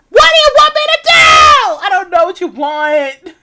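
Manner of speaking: very high, loud scream; anguished shout. Acted. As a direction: scream it in despair. Shouting at game character.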